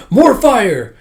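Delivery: high, loud battle cry; little wizard Akane. Acted. Young performer as little wizard, high excited shout. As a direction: cast it like a spell, shouting. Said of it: "like a little wizard Akane".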